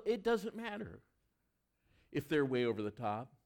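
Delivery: usual speaking voice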